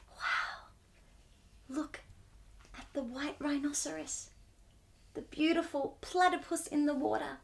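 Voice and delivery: soft, breathy wonder at the animals; hushed awe. Real, not acted. Reader pointing out the rhino to children, hushed. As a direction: whisper it like you don't want to scare them.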